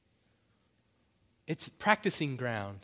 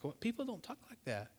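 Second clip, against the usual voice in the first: quiet, high-pitched disbelief; taken-aback cashier; 7 semitones higher, 10 dB quieter than usual. Acted. Cashier amazed by a customer's thanks, softly incredulous. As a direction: softly act out astonishment. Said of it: "That lady's like".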